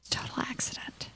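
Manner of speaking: whisper, no voice; hushed guilty aside. Real, not acted. Gamer whispering it was an accident.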